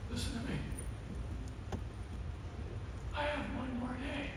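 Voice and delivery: high, very soft plea; dying dad demanding his son listen; frail father. Retold or imitated. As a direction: press it gently but urgently.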